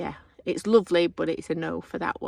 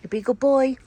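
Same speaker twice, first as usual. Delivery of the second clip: talking to a dog; 7 semitones higher, 5 dB louder than usual. Real, not acted. Telling the dog to behave.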